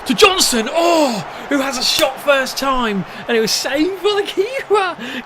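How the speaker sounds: excited shouting. Real, not acted. Calling his striker's shot live, excited shout. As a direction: shout the chance as it happens.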